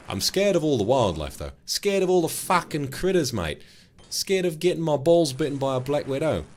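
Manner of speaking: high, loud Aussie whine; hammed-up Australian fretting; mock Australian accent. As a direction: complain high-pitched in a put-on Australian accent. Puts on Aussie talk about critters.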